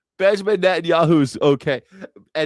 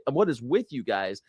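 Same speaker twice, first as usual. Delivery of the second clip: ordinary delivery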